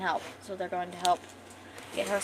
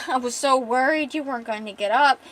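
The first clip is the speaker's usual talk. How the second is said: ordinary delivery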